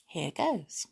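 ordinary delivery

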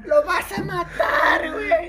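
high, loud, slow mock crying shout; frantic yell. Mother screaming at her son to stop beating the boy, mock crying shout. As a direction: wail it like a panicked mother. Mom yelling to stop fight.

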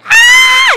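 high piercing mock yell; mock shout. Emerging singer yells at the world.

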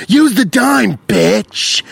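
high, exasperated cry with a stretched last word; Jesse Pinkman. Acted. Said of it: "it sounded like Jesse Pinkman".

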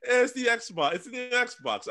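mock Rudy voice. Voicing Rudy's answer.

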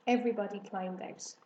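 plain reading voice

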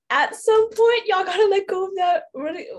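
exasperated plea. Real, not acted. Pleading with British people.

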